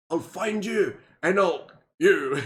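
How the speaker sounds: slow, gruff mock Neeson growl; Taken threat. Parodying Liam Neeson's Taken threat, slow mock growl. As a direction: lean into the threat slowly. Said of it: "He also says".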